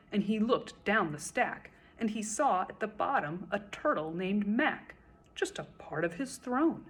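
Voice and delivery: ordinary delivery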